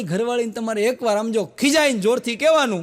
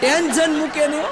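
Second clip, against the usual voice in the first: louder, higher exasperated cry; comic outburst; 7 semitones higher, 4 dB louder than usual. Acted. Comedian on stage to the audience, loud mock exasperation. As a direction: throw it out loud in mock despair.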